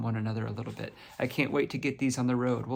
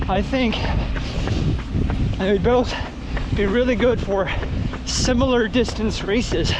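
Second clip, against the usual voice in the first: out of breath while running; 10 semitones higher, 8 dB louder than usual. Real, not acted. Talking while running the shoes.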